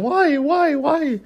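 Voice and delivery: very high, loud pained cry; slapped fan holding his face, hammed-up wail; mock wailing. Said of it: "holding his face, saying".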